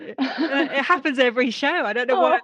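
laughing through tears; a real tearful giggle; teary laugh. Real, not acted. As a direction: laugh it out while welling up.

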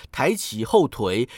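usual speaking voice

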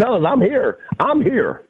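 high, loud, laughing "I'm here"; high-pitched gleeful boast with a laugh; triumphant announcement. Retold or imitated. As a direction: burst in proclaiming yourself to the guys. Announcing return to teammates, laughing.

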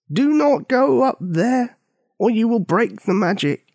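put-on high, slow, solemn old man voice; old man's warning. A narrator's reading. Old man warning villagers away, slow and solemn. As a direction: intone it like a wise old keeper.

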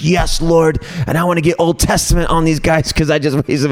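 high-pitched, eager answer to God; mock righteous zeal. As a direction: play up indignant eagerness for judgment. Acts out zealous reply to God.

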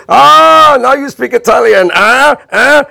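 put-on high, loud Italian-accented gloat; triumphant Italian host. Host mocking Bob's Italian, loud triumphant shout. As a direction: crow over him in an Italian accent. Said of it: "and he went".

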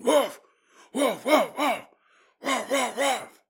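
playful woof woof impression; imitated barking. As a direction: bark like the angry dog. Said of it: "It starts barking".

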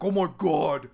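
loud mock-indignant rant; worked up, angry. Angry student venting to her mom, imitated as a worked-up rant. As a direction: imitate an angry woman's heated complaint. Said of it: "she's just worked up and angry".

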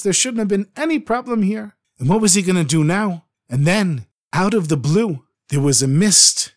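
usual speaking voice

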